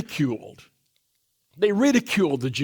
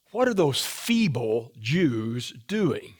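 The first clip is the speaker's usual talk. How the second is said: mocking sneer; 5 semitones lower, slower than usual. Preacher voicing Sanballat mocking the builders, slow low sneer. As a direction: sneer it out slowly like an enemy taunting. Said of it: "And then he says this".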